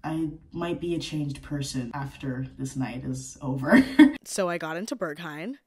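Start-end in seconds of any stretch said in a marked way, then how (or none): none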